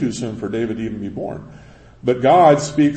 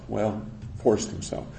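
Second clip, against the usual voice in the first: quiet aside; 3 semitones lower, 8 dB quieter than usual. Real, not acted. Muttered aside.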